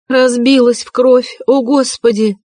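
alarmed exclamation, pitched high; shocked cry. A narrator's reading. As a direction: gasp out at the blood. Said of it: "вскрикнула Соня".

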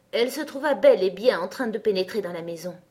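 usual speaking voice

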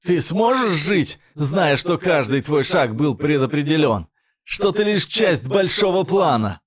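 slow, high, shouted villain taunt; angry villain. A narrator's reading. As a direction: spit out the fury slowly. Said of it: "в этом смехе сквозила злость".

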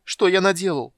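high, loud despairing cry; helpless cry. A narrator's reading. Kender cries out helplessly on his knees, high and loud. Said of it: "беспомощно вскричал он".

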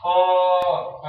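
خاء held long and slow; drawn-out letter name. Shown as a demonstration. Teacher stretching خاء for the pupils. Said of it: "حرف".

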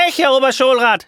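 shouting claim. Acted. The real teacher shouts to the school inspector, loud and high. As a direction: shout to claim you are the real one. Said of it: "schreit der Echte".

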